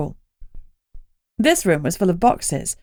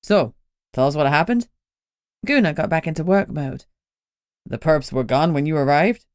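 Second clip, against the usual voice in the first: lower, matter-of-fact questions; all business now; calm police questioning; businesslike cop; 3 semitones lower than usual. Acted. Gunnar in work mode.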